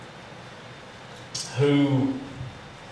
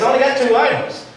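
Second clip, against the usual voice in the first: shocked disbelief; 9 semitones higher, 5 dB louder than usual. Retold or imitated. Shocked customer protests bill.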